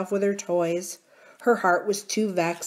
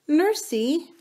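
coaxing princess; 9 semitones higher than usual. A narrator's reading. Princess calls to crying nurse.

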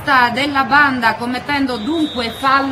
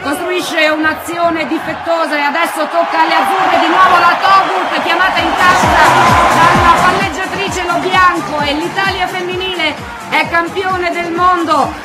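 excited victory call. Real, not acted. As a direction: shout the winning point with joy. Match point, Italy wins title.

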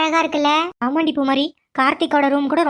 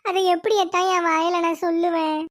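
put-on sobbing. Niece putting on grief for her aunt, sobbing. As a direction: fake sobs through the words.

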